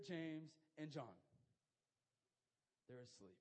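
ordinary talk, nothing marked

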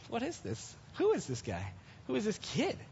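hushed, incredulous questions; puzzled Goliath muttering. Acted. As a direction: wonder under your breath who this could be. Goliath peering at small David.

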